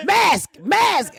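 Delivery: sharp high mock yell of an order; mock casino worker yelling; mock shout. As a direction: bark it like a pushy attendant. Imitating casino worker demanding mask.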